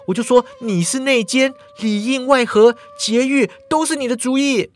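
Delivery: put-on a frantic girl bossing her friend about; flustered young woman. Mingyue panicking.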